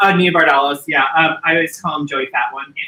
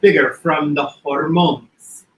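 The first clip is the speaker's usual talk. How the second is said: Aunt Voula quote. Retold or imitated. Quoting the aunt about the lump, mild Greek accent. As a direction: confide it with a light Greek accent. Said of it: "my other favorite line is".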